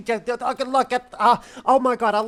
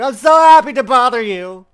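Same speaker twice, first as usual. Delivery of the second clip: hammed-up cheerful, much louder; loud mock cheer; 3 semitones higher, 15 dB louder than usual. Shown as a demonstration. Mocking the game line with an overblown happy shout. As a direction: belt it out with fake glee.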